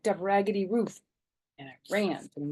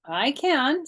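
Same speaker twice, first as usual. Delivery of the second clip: smug friend; 9 semitones higher, 7 dB louder than usual. A narrator's reading. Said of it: "said that Grace".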